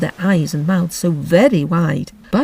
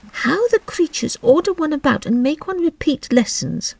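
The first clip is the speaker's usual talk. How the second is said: Alice voice; 10 semitones higher than usual. Acted. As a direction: speak like a polite little girl.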